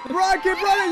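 high, excited shout; urging yell. Real, not acted. Player spurs a fleeing friend on, urging yell. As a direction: cheer the runner on urgently.

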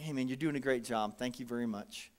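low, soft reassuring words; kind encouragement. Retold or imitated. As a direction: quietly build up the tired waitress. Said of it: "I'm like".

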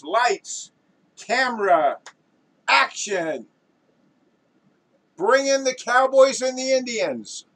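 high, loud director's commands; director's yell. Acted. Film director to cast and crew, yelling commands. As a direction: call out orders across the set. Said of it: "as soon as you yelled action".

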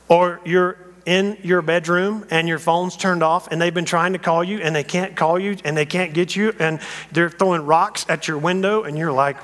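normal talking voice

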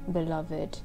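plain reading voice

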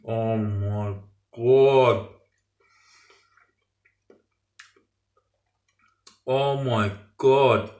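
very slow, drawn-out exclamation; savouring awe. Real, not acted. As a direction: stretch each word over a delicious mouthful. Reacting to tasting the prawn.

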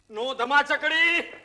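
drawn-out warning. Acted. Officer telling madam not to start a scuffle, drawn-out warning. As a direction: stretch the words out to ward someone off.

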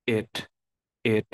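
"it" with a short vowel, between e and i; short lax I. Shown as a demonstration. Teacher models the pronoun "it" with short vowel. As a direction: keep "it" brief and lax, never ee. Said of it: "Tú quieres decir el pronombre".

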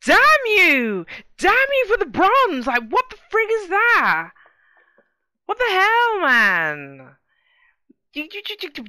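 loud, high mock outrage at the bronze; hammed-up fury, yelling high; playful outraged shouting at the game. Angry at bronze birthday result.

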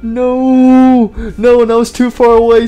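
loud, high mock wail; hammed-up cry of grief over a fallen friend; a loud, overdone lament; mock anguished yell. Mock grief over character death.

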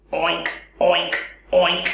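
low playful oinking; pig oink. Pretend. Pig sound after naming pig.

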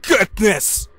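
loud cry of fright; frightened shout. Real, not acted. Player startled by the monster, loud frightened shout. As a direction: blurt it out shaken. Jump scare.

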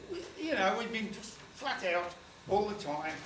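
usual speaking voice

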